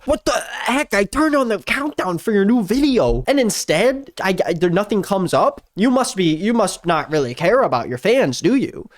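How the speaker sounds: high-pitched mock outraged fan; put-on voice of an offended subscriber; indignant viewer. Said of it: "they're gonna go".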